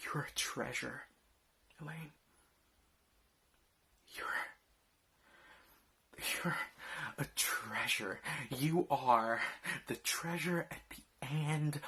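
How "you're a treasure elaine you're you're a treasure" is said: whispered. Acted. Bitter hushed address to wife.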